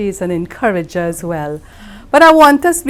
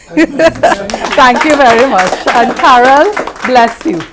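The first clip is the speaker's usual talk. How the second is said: 1 laughing thanks; 4 semitones higher, 7 dB louder than usual. Real, not acted. Laughing thanks after closing joke.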